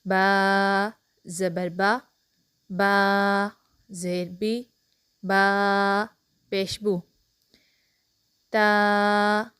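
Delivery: "बा ज़बर बा" slow, बा long, final vowel short and clean; takhti drill. Shown as a demonstration. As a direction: recite the board letter by letter. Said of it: "बग़ैर खींचे और बग़ैर झटका दिए मारूफ पढ़ें". ("बा ज़बर बा" तख्ती पढ़ने का अंदाज़)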